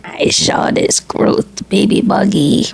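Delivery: slow, hammed-up dramatic line; mock soap melodrama. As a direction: draw it out like a soap opera confession. Mock soap opera retelling, dramatic music.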